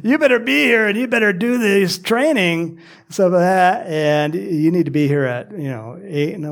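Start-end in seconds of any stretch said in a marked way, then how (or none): 0.0-2.8 louder, higher mock coach orders; a coach ordering the team to train; coach demand. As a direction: lay down the law like a coach. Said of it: "the coach is saying".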